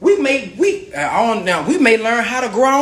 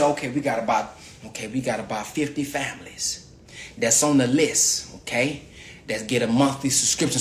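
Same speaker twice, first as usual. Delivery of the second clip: acted list check; 11 semitones lower, 7 dB quieter than usual. Acting out staff going down list.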